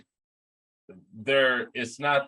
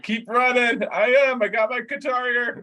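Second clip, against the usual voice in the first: much higher, cheery, carefree running voice; mock cheerful Matt; 11 semitones higher, 4 dB louder than usual. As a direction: play the oblivious bandmate, high and bright while chaos reigns. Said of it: "you're just like".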